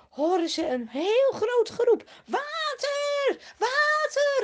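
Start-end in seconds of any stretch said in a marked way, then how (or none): 2.3-4.4 very high, loud joyful cry; shouted call. Acted. Thirsty man in front calling out he found water. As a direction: call out to the others who follow. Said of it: "roept de voorste".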